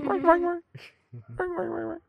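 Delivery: very high chirping calls; bird chirp. Pretend.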